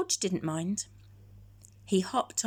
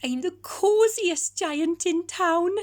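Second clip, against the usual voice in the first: cried happily; 9 semitones higher, 7 dB louder than usual. Acted. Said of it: "he cried".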